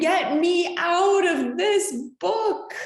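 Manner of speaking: high panicked yell, voice shifted; high scream. A narrator's reading. Bookmark on shark page screams to get out. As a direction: shriek to be let out. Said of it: "and I screamed".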